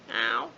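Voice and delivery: low, loud rising meow; a low mock meow that rises at the end; imitated cat meow. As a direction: drop the pitch low and rise on the meow. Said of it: "That's all she does".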